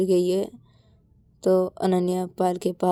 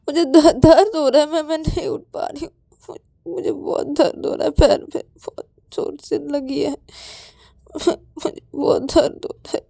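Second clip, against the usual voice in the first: high, sobbing complaint; tearful, pained whimper; crying in pain; 8 semitones higher, 4 dB louder than usual. Acted. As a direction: wail that it really hurts. Payal cries in pain.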